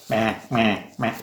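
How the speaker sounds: mock the sound of masked talk; mocking mask mumble; muffled mocking bleat. Shown as a demonstration. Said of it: "you have to talk like this".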